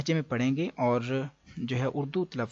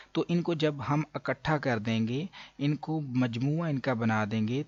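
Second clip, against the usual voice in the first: ordinary delivery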